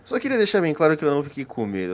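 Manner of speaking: fast, slurred tipsy ramble; drunk voice. Acted. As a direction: rush it out like a tipsy man. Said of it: "ele tá meio bêbado já".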